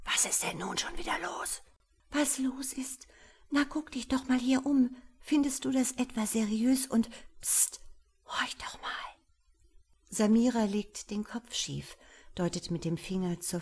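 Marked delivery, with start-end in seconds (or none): 0.1-1.6 soft hissed aside; hissed quietly. Acted. Husband hisses to his wife, softly. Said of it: "zischt dann so leise". 2.1-8.9 high, breathy anxious whisper; frightened whisper. Acted. Wife whispers her fears to her husband, high and anxious.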